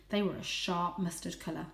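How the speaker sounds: usual speaking voice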